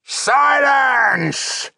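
very high, loud orc roar; furious bellow. A narrator's reading. Orc chieftain silencing a troublemaker, intimidating bellow. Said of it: "he bellowed".

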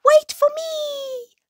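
very high, tiny squeaky call; dormouse's cry. A narrator's reading. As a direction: squeal it out as a little mouse left behind. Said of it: "cried Dormouse".

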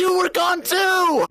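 high, stretched-out yell of protest; indignant shout. Real, not acted. Player answering an accusation after the scare, indignant high shout. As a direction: holler back in outrage.

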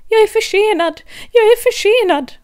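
high, loud panicked rabbit cry; calling out late. Acted. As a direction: rabbit crying out in a rush.

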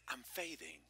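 hushed confiding whisper. Real, not acted. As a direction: drop to a secret whisper. Preacher drops to a confiding aside.

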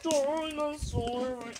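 slow, drawn-out dog voice. Pretend. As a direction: talk as if the dog could talk. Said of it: "that's actually my dog voice".

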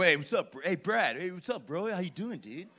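lightly voiced laid-back rich dude.

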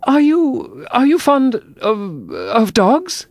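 high-pitched, halting question; hesitant Alice. A narrator's reading. Alice gropes for a new subject, high hesitant voice. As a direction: feel for the words slowly, high and tentative.

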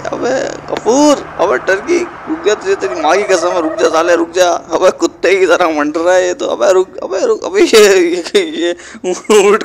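high pleading yells to stop; desperate yell. Acted. Falling behind and begging Ghafoor to stop, high desperate yells. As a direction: beg the rival to stop, voice climbing. Losing race, yelling at rival.

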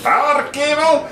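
high-pitched German mad scientist. Retold or imitated. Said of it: "mad scientist with that nice German accent".

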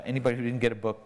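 normal talking voice

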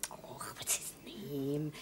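slow, hushed whisper to herself; murmured under the breath, groping for a name; whispered aside. Real, not acted. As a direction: drop to a whisper while searching for the name. Trying to recall a name.

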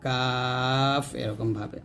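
কাফ stretched very slow with a long open vowel; drawn-out kaf. Shown as a demonstration. Teacher contrasting the letter with kof, drawn out slowly. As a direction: draw out কাফ slowly, light and thin. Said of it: "এখানে আওয়াজ used হবে".

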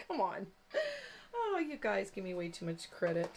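normal talking voice

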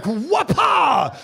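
high, loud comic shout of a blow; mock sword whack. Preacher acting out Peter cutting off the ear, comic shout. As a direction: belt out a cartoon hit noise.

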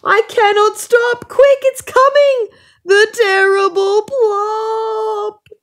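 high, loud panicked bear voice, last words slow; bear crying back. A narrator's reading. Bear crying back to the rabbit in panic, high and loud. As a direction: wail it back as the frightened bear. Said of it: "The bear cries back".

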